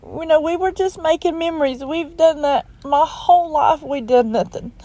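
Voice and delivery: holding back real tears while speaking; real crying, voice cracking up high. Real, not acted. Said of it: "I don't mean to cry on you".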